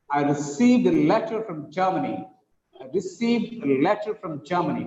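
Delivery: regular beats on "received", "letter", "Germany"; rhythmic stress. Shown as a demonstration. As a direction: let the voice rise and fall on each stressed word. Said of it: "the stress arises in regular intervals".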